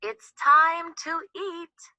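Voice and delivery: called out. Acted. Little Chick calls her family to eat, loud high call. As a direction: call everyone to the garden. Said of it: "Little Chick called".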